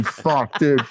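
usual speaking voice

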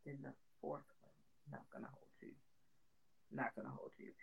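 barely voiced, very soft and low; near-whispered admission to yourself; muttered aside. Real, not acted. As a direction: mutter it under your breath. Hesitant ranking, muttered to self.

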